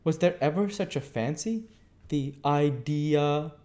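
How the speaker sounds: scandalised, dragging out the last words; indignant prim cousin. Acted. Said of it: "add with indignation".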